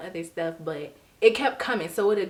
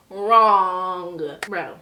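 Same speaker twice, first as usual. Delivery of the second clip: much louder punchline; loud punchline correcting herself; emphatic; 9 dB louder than usual. Real, not acted. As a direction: slam the word down as the twist. Punchline reversing her hope.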